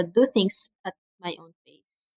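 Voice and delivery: ordinary delivery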